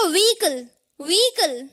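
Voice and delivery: व्हीकल as "vee-kal", stress on the ee; silent h. Shown as a demonstration. Teacher showing what it becomes, व्हीकल as "VEE-kal". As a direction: say व्हीकल with long ee and no h. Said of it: "उसे हमें stress देना है".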